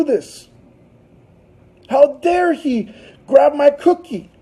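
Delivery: high, slow, indignant outrage; mock fury. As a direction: stretch each question in offended disbelief. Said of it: "she's getting furious".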